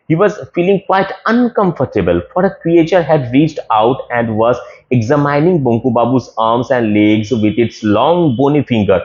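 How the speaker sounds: ordinary delivery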